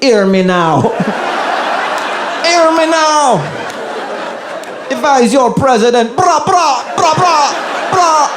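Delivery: high-pitched, hammed-up Jamaican accent; mock politician pandering in Jamaican patois; Kamala, Jamaican accent. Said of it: "like, a bunch of Jamaican people".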